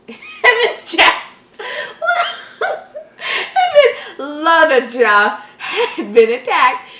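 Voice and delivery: high, slow, helpless laughter; laughing. Real, not acted. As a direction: laugh through the sentence. Reader cracking up at error.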